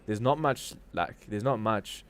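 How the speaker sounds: usual speaking voice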